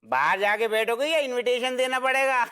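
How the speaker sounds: normal talking voice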